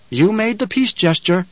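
high, lilting tenor; high musical adviser; sly henchman. A narrator's reading. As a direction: needle him sweetly. Voices Piter in the story.

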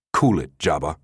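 understated, controlled command; firm order. A narrator's reading. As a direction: order him to settle, without raising the voice. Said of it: "the director ordered".

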